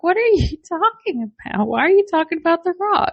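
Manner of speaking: baffled. Retold or imitated. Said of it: "I'm like".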